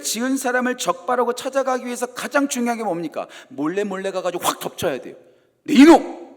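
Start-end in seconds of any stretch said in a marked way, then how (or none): 5.6-6.3 loud high startling bark; sudden shout. Retold or imitated. Catcher of a sinner, loud startling shout. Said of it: "이렇게 잡아야 돼요".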